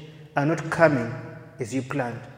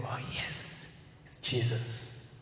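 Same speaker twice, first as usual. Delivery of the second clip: hushed whisper; 2 semitones lower, 15 dB quieter, slower than usual. Retold or imitated. Disciples recognising Jesus, hushed.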